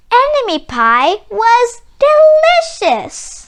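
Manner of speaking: high, loud, slow savoring; delighted surprise. Acted. Boy tastes the pie and finds it delicious, slow high delight. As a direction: relish each word like a great discovery.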